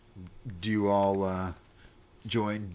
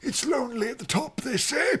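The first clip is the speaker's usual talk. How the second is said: put-on high, frail old man voice; quavering elderly temple master; 4 dB louder than usual.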